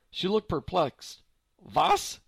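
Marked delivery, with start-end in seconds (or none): 1.7-2.2 high, loud German "Was"; startled old lady; puzzled old woman. A narrator's reading. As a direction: ask baffled, hard of hearing.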